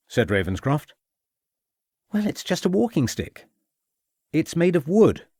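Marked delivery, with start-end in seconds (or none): 2.1-5.3 bewildered. A narrator's reading. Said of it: "replied a bewildered Armitage".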